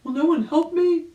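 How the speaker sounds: high, loud desperate call; desperate plea. A narrator's reading. Rabbit pleading for rescue in high loud voice. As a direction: beg anyone to come save you. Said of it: "he cried".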